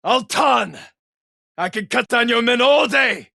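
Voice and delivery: roaring taunt at the enemy; battle shout. Acted. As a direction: bellow a challenge across the field. Challenging warlord across battlefield.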